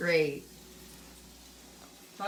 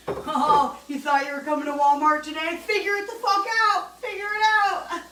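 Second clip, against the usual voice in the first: high, loud, fast mocking taunt; mocking Walmart staff; 7 semitones higher, 6 dB louder than usual. Ranting about Walmart, she voices smug staff in a high, loud taunt. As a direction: taunt the shopper like a smug store clerk. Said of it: "And they're like".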